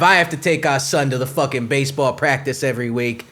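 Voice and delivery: ordinary talk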